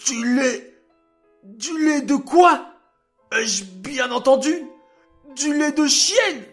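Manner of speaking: high, loud furious outburst; furious king. A narrator's reading. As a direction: rage like an outraged king. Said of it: "devint rouge de colère".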